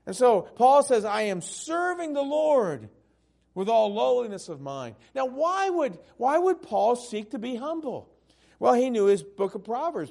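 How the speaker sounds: loud, high pulpit preaching. Acted. Preacher quoting Paul to the church, loud and high. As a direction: proclaim it to the whole church.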